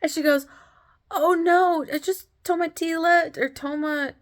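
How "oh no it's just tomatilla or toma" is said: high-pitched imitated vendor; reassuring taco seller. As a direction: wave off the worry as the seller. Acting seller's reassuring answer.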